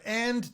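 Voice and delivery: "and" pitched high and leaned on; stressed and. Shown as a demonstration. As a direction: hit "and" for drama. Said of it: "for dramatic effect".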